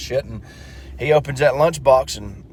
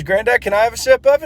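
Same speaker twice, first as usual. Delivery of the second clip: put-on high child voice; little kid voice; 9 semitones higher than usual. Little Johnny asking grandpa, high childlike. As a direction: pipe up like a small boy.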